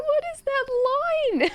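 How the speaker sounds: high squeaky incredulous question; high cringing question; mock disbelief. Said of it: "I was like".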